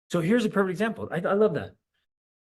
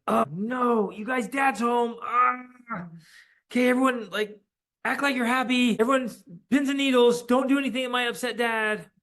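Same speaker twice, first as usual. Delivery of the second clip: panicked warning; 7 semitones higher than usual. Retold or imitated. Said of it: "you're like".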